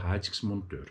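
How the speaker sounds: ordinary talk, nothing marked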